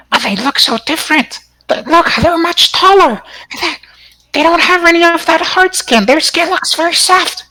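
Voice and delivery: very high, chattering little monkey; squeaky monkey voice. Acted. Game master voicing a monkey to the players, very high squeaky voice. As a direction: pitch way up like a curious monkey.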